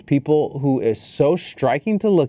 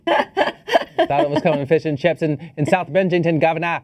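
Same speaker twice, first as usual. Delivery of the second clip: hammed-up mock British, wandering toward Boston; comically botched British accent; bad British accent; 2 semitones higher than usual. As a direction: badly fake a cockney-style British "governor". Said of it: "your British accent is about as good".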